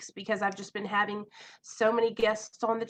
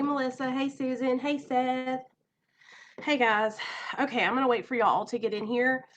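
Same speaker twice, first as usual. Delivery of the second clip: ordinary talk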